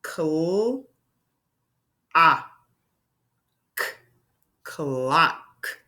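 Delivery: clock split slowly into cl, ah, k; segmenting sounds for children; sounds tapped out. Shown as a demonstration. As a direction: tap out each sound then blend. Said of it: "Let's tap it out".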